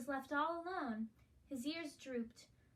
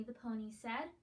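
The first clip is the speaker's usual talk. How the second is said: ordinary delivery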